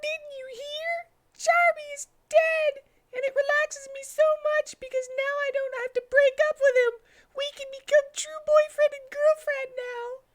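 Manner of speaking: put-on very high squeaky girl voice; shrill little rabbit, gushing; Cream voice. Cream telling Tails the news, very high voice. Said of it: "said Cream to Tails".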